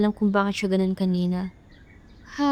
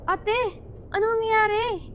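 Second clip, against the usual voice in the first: alarmed; 12 semitones higher than usual. Acted. Sister alarmed by sick sibling.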